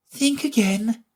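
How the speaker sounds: high, light fawn voice. A narrator's reading. Fawn asking Alice to think again, high and light.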